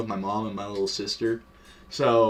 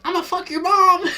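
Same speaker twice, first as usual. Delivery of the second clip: very high, loud mock kid voice; squealed taunt, pitched way up and louder; squeaker trash talk; 6 dB louder than usual. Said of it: "talking shit".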